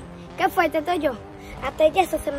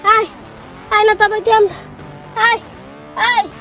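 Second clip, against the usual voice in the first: panicked cries; 4 semitones higher, 7 dB louder than usual. Real, not acted. Kid panicking during lift.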